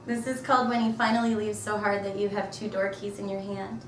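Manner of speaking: ordinary delivery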